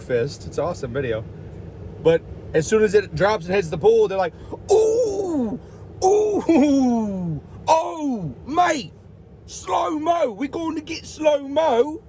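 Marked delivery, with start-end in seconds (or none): 4.7-12.0 high, excited mock Australian accent, building to a shout on slow-mo. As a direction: play the hyped Australian guys cheering the slow-mo. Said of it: "They're Australian, aren't they?".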